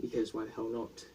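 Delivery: ordinary delivery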